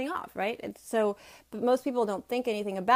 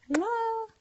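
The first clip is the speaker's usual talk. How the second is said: high, cheery sign-off; kiss goodbye; 10 semitones higher, much slower than usual. Real, not acted. Vlogger signing off to viewers, cheery kiss. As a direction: blow a kiss and wave off.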